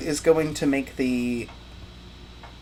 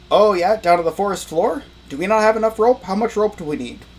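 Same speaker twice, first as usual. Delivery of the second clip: higher and louder, caught off guard; a startled manager, louder and higher; surprised office boss; 6 semitones higher, 6 dB louder than usual. Acted. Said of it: "Uncle goes".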